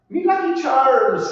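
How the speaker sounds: high, slow, loud Irish lilt; hammed-up Irish brogue, slowly; Irish accent. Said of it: "anytime I wanna do an Irish accent".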